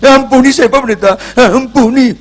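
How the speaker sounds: high, loud pleading cry; begging for forgiveness in a high, crying voice; tearful begging. Retold or imitated. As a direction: beg the pastor for mercy, near tears. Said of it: "Langsung nangis".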